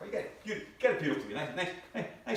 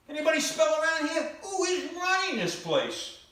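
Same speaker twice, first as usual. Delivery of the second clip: high, loud fed-up outburst; loud indignant whine; exasperated yell; 13 semitones higher, 5 dB louder than usual. Acted. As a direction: demand answers in mock outrage. Exasperated rant at the venue.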